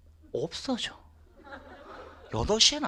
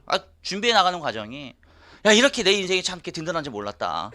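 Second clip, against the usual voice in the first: ordinary delivery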